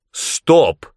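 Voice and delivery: high, sharp halt; shouted command. A narrator's reading. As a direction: cut them off with a cry. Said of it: "крикнул Аас".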